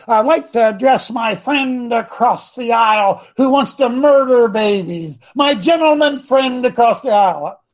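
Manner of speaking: slow, drawn-out senate oratory; pompous stand-up-in-the-Senate speech; mock senator. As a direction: imitate a ceremonious politician addressing the chamber. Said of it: "some guy that stands up in the Senate and says".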